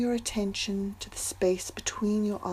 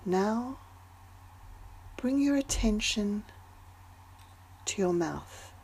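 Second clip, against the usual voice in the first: guided meditation. Acted. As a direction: ease them into calm with long pauses. Leads a breathing meditation.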